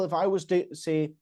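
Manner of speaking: usual speaking voice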